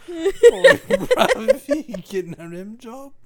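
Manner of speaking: very loud, high laughing Aussie; hammed-up Aussie; mock Aussie accent. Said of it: "Aussie bit continues".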